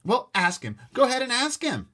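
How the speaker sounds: high, loud challenge; argumentative. Acted. Son challenging his mother, loud. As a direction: dare her to ask.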